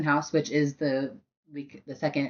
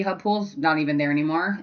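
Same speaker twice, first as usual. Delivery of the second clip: ordinary delivery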